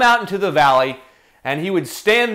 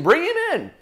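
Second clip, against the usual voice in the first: king summoning; 11 semitones higher than usual. Acted. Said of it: "he calls him".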